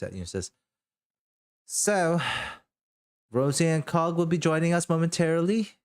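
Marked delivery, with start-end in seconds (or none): 1.7-5.8 higher, composed hostess announcing guests; a light NPC voice, lifted pitch; Elaine's voice. Acted.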